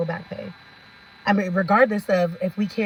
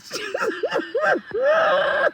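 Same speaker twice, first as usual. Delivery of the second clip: very high squealing laugh; long, wheezy cackle; helpless laughter. Real, not acted. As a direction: crack up completely. Laughs at own joke.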